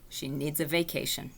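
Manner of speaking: soft, tired sigh of a line; weary. Acted. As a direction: mutter it worn out. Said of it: "she closed her eyes".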